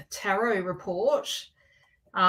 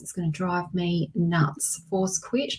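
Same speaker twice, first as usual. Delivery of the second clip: ordinary talk, nothing marked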